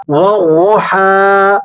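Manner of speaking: وَضُحَا stretched slowly, ض thick and full; heavy daad demo. Shown as a demonstration. Teacher reciting وَضُحَا from Surah Duha, slow. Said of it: "پہلا لفظ ہے وَضُحَا".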